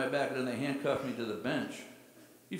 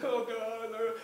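put-on young girl voice; 9 semitones higher than usual. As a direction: sigh it like a frightened girl. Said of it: "She's like".

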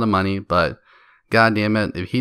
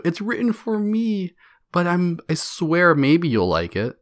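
much higher, slow mocking voice; mock whiny author; 6 semitones higher, slower than usual. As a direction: play a self-absorbed writer. Mocking a self-absorbed author.

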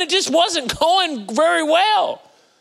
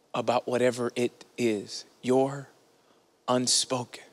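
hushed sermon appeal; 14 semitones lower, 7 dB quieter than usual. Acted. Pastor hushes the congregation toward prayer, softly. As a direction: let it sink in quietly. Leads a quiet reflective appeal.

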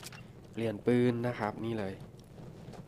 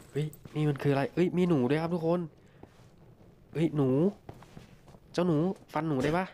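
excited; 5 semitones higher, 3 dB louder than usual. Real, not acted. Spots a rat, excited.

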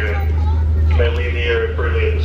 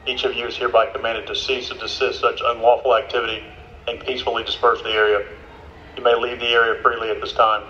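dispersal order over a megaphone; police megaphone. Real, not acted. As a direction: read it like a police announcement.

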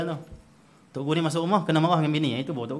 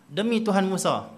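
mild annoyance; 6 semitones higher, 5 dB louder, slower than usual. Retold or imitated. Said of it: "Bila mana kamu kalau marah".

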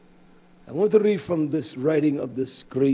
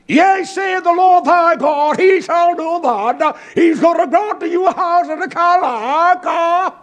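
voice of God; 13 semitones higher, 10 dB louder than usual. Acted. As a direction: boom it out as the Lord himself speaking. Quoting God's words in scripture.

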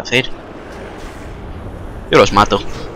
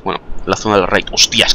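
ordinary talk, nothing marked

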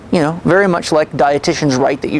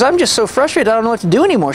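imitating her higher, upset and on the verge of tears; tearful frustration; 7 semitones higher, faster than usual. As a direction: voice her despair, pitched up and tearful. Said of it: "so she started crying".

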